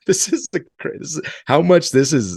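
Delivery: high, half-laughing disbelief; amazed at himself. Retold or imitated. Recruit laughing at praying to God with sir, amazed. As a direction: marvel at your own habit, voice jumping up. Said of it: "And I'm like".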